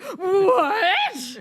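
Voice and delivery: high, loud, laughing "What?"; hammed-up disbelief, voice cracking up; mock shocked men. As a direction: play the clueless guy, shocked and squeaky. Guys react to harassment news.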